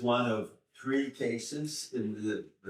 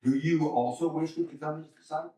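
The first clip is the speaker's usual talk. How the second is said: quick, higher-pitched snarky question; 5 semitones higher, faster than usual. Acted. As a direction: toss it out as a snide question. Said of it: "kind of snarky".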